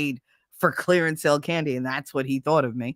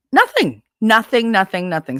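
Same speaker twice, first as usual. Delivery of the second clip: 1 emphatic repeated nothing; 4 semitones higher, 7 dB louder than usual. Retold or imitated. Building mock outrage over silence.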